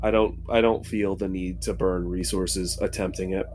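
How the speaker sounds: normal talking voice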